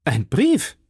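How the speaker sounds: surprised cry. A narrator's reading. D'Artagnan handed a paper, mildly surprised cry. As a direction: call it out as a surprised question. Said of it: "rief der junge Mann".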